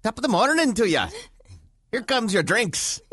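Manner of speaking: high, put-on Irish accent; mock Irish accent, high. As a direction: do a hammy Irish bartender. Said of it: "try and do an Irish accent".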